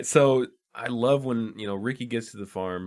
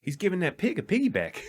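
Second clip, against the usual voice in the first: put-on New Zealand accent; 10 semitones higher, faster than usual. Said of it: "quoting the constable".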